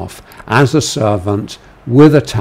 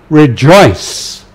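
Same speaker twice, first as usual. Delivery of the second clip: raised, louder exhortation; commanding voice; 4 semitones higher, 5 dB louder than usual. Shown as a demonstration. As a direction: call them to joy. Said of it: "as Peter makes these exhortations".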